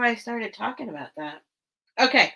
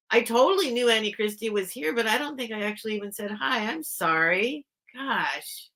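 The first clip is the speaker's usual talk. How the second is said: usual speaking voice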